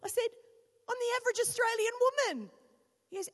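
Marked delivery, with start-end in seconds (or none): 0.9-2.5 high, loud, fast and thrilled; wife proudly announcing to husband; excited announcement. Retold or imitated. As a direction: burst it out like big news.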